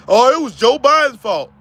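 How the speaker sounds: loud, sneering parody of the excuse; mocking quote. Mocking Trump supporters who blame Biden, in a sneering voice. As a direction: mimic them with scorn.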